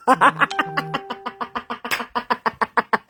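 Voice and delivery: long, rattling run of laughter; long mocking laugh. Real, not acted. Laughing at the sister's attempt at English, long and rattling. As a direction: laugh on and on in a steady stutter.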